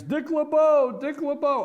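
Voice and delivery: loud, high mock chant; clamoring fans. Host imitates Steeler fans objecting, loud high chant. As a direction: holler it like fans insisting on their man. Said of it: "I know Steeler fans".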